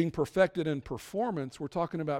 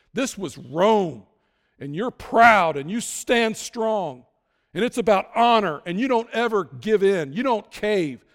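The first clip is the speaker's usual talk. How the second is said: louder, slow, hammering each phrase about Roman pride; preaching at full force; 8 dB louder, slower than usual. Acted. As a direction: preach it like a proud Roman creed, building.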